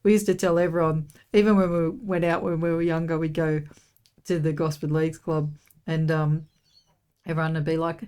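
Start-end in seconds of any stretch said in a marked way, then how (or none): none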